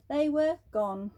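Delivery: usual speaking voice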